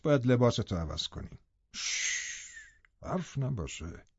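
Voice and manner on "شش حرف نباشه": shushing. Acted. Heathcliff silencing Linton.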